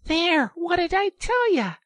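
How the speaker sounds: very high, loud crowing; triumphant. A narrator's reading. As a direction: crow that you were right. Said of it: "exclaimed the rat in great triumph".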